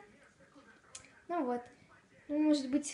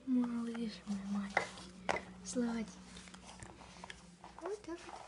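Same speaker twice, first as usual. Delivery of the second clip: tender whisper; 6 dB quieter than usual. Real, not acted. Cooing to puppy.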